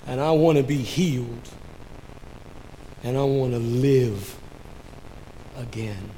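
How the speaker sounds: hushed, halting confession; subdued, long-paused retold longing; imitated tearful plea. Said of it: "With tears streaming down his cheeks".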